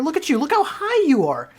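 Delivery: high, loud burst of cheering; excited praise. Acted. Teacher cheering on a student's high jump, excited. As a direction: gush loudly at the student's jump.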